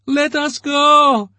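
a woman squealing at the major; woman's squeal. A narrator's reading. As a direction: squeal it above the roar. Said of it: "a woman's voice squealed".

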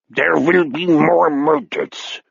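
slow, hammed-up German accent; thick German accent. A narrator's reading. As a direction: burlesque German accent, drawn out. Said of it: "The accent much thicker now, a burlesque".

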